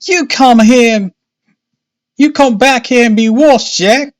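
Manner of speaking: high, loud mother's scolding call; shouted. A narrator's reading. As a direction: holler high and loud after a runaway child. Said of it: "she shouted".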